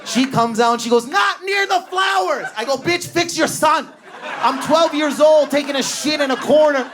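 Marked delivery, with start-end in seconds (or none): 1.1-2.5 grandma yelling. Retold or imitated. 2.8-6.9 frantic yell. Retold or imitated. Frantic retort to grandma.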